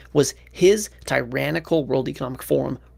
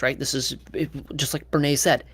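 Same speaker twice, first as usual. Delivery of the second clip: ordinary talk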